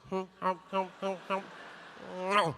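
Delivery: rhythmic mock pecking hums; imitated pecking hum. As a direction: hum it like a woodpecker mid-peck. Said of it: "so it was going like".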